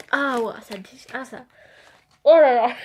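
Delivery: gasping, loud oh là là; overwhelmed by the heat; mouth burning from spicy food. Real, not acted. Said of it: "j'ai la langue en feu".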